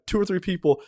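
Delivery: ordinary delivery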